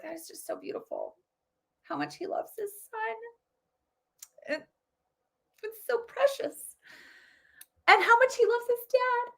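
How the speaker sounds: mocking a tearful centrist cooing over a sweet moment; mock gushing. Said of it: "The middle was probably like".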